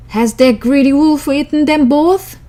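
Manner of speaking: outraged woodcutter shouting; alarmed. A narrator's reading. Father finds wolf.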